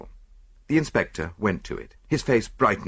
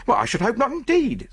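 virtuous; 3 dB louder than usual. Acted. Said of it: "said the chambermaid virtuously".

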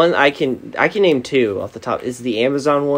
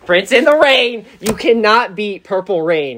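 emphatic insistence; 6 semitones higher, 5 dB louder than usual. Real, not acted. Insisting [name] is best.

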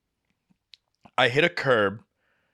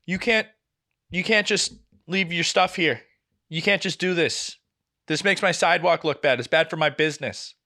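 indignant shop owner; 5 semitones higher than usual. Retold or imitated. Imitating the business owner.

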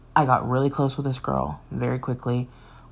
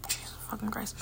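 whispered under a sigh, much softer; exasperated whisper; 7 semitones higher, 15 dB quieter than usual. Real, not acted. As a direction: breathe it out in disbelief. Sighing at the crush reveal.

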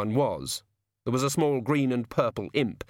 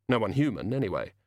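usual speaking voice

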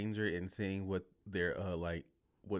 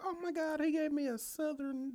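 very high mock-thrilled squeal; imitating mother-in-law. Co-host voicing the mother-in-law about a nickname, high mock gush. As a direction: play her gushing, pitched way up. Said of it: "She be like".

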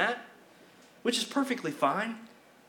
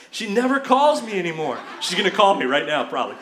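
laughing through the complaint about mom; half laughing, louder; teasing laugh at mom who is watching; 5 dB louder than usual. Real, not acted. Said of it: "laughing about mom".

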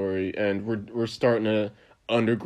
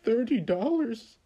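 shocked customer; 12 semitones higher than usual. Retold or imitated. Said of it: "he was just like".

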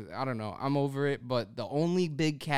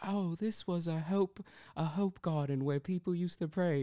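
nature documentary narrator; 5 semitones higher, 5 dB quieter than usual. Retold or imitated. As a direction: imitate the Planet Earth narrator. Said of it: "he sounds like the dude from Planet Earth".

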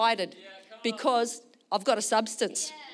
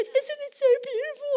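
very high mock-tearful voice; weepy mum, overcome; crying mother; 5 dB louder than usual. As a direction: squeak it out through imitated happy tears. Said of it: "And she's, like, crying".

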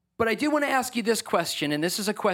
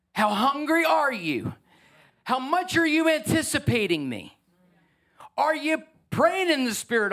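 passionate preaching; 7 semitones higher than usual. Real, not acted. Preacher challenging the congregation on their hunger, passionate. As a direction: draw out the question, pitched high and urgent. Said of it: "I'm passionate".

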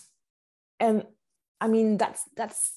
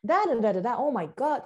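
"Da, da, da" bouncing up and down like a tune, into a higher "Oh my God"; singsong English rhythm; 4 semitones higher, 3 dB louder, faster than usual. Shown as a demonstration. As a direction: lilt "Da, da, da" like singing. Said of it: "it's more like singing".

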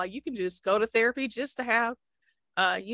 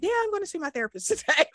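breezy, quick and higher voice; casual quote; 9 semitones higher, much faster than usual. Retold or imitated. A friend breezily mentioning her therapy plans. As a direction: toss it off lightly and fast. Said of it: "openly talk about".

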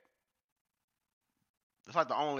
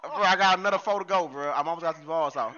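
loud, higher bro protest, laughing; frat bro; 5 semitones higher, 9 dB louder than usual. Real, not acted. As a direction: yell at him to chill, bro. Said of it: "I'm back in character".

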